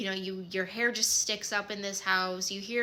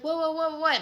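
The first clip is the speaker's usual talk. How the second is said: high-pitched startled whoa; alarmed, rapid-fire reaction, higher voice; startled parents; 8 semitones higher than usual. Retold or imitated. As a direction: imitate parents caught off guard.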